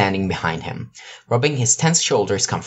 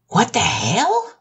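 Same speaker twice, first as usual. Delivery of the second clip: high, slow, stunned question; stunned disbelief; 13 semitones higher, much slower than usual. Acted. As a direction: drag it out in pure shock. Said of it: "so shocked that his volume actually went down".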